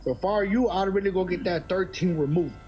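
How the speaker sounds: ordinary talk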